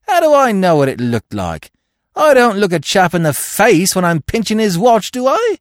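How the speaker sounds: high, loud aggrieved protest; affronted Cockney crook; indignant. A narrator's reading. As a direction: protest hotly, climbing to the final question. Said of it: "cried Billy indignantly".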